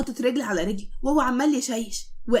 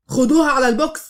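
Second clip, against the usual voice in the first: shouted command, much louder; loud order; 2 semitones higher, 7 dB louder than usual. A narrator's reading. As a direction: bark it at the soldiers. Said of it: "وقال بصوت عالي للعساكر". (الظابط بيزعق للعساكر بصوت عالي)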